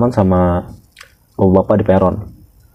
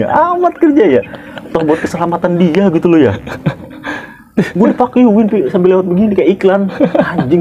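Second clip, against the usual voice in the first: indignant rant; 10 semitones higher than usual. Real, not acted. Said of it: "indignant rant".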